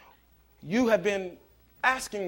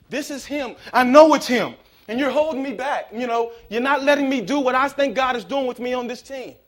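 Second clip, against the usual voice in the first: higher, louder mock protest of a sure sister; insistent sister, acted out; 6 semitones higher, 7 dB louder than usual. Pastor imitating the sister who insisted, loud and indignant. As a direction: voice her stubborn certainty, hammed-up and loud.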